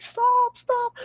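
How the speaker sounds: very high, loud mock wail; near-crying yell. Man retelling kid begging at bathroom door, high panicked yell. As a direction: yelp it like a panicked little kid. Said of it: "I'd, like, yell, I'd, like, damn near cry".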